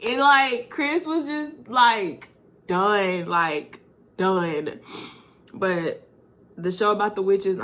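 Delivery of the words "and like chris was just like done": ordinary talk, nothing marked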